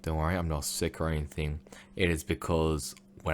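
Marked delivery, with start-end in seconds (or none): none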